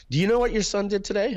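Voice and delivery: a mom reporting the mischief; tattling mother. Retold or imitated. Mother telling the father what the son did, high-voiced.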